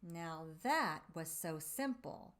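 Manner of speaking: ordinary delivery, nothing marked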